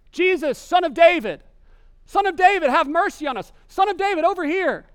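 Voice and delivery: high, loud desperate calls for attention; yelling out. Acted. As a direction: shout across a road to be noticed. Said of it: "start yelling out".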